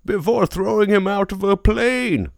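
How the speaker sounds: muffled masked villain voice; Bane voice. Retold or imitated. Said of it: "That's a great Bane".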